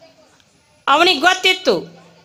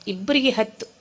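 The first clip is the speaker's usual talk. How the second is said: ordinary delivery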